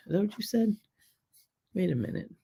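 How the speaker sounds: normal talking voice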